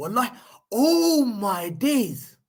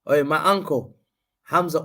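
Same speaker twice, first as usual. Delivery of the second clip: ordinary talk, nothing marked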